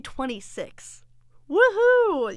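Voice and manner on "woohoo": loud, high mock woohoo; sarcastic cheer. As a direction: fake celebration of bad news. Mock cheer at his lie.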